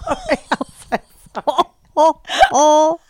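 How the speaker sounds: apology through a laugh; giggling sorry, dry throat. Real, not acted. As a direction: say sorry while still laughing. Laughing apology after choking.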